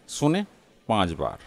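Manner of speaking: ordinary delivery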